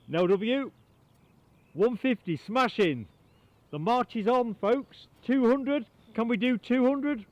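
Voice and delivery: loud, high, rallying auctioneer-style calls. Real, not acted. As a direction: drum up bids like an auctioneer. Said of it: "I don't want to sound like an auctioneer".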